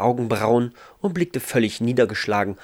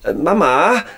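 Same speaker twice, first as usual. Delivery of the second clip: raised, higher-pitched call to another room; 10 semitones higher, 5 dB louder than usual. A narrator's reading. Ralf calls to his mother in the kitchen, calling out. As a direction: call out uncertainly for Mama. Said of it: "öffnete sie und rief".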